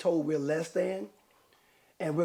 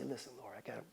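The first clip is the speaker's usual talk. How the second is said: smooth hustler pitch; 5 semitones lower, 13 dB quieter, faster than usual. Pretend. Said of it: "If I say".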